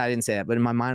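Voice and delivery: usual speaking voice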